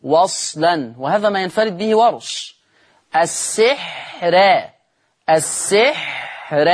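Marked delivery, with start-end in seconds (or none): 3.2-4.3 السحر with the ra kept thin and light, slow. Shown as a demonstration. Teacher to students, slow model of a thin ra. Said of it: "را مش را". 5.3-6.6 السحر slow with a thin ra; thin light ra. Shown as a demonstration. Teacher to Warsh students, slow thin ra. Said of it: "أنا عايز أسمعها را".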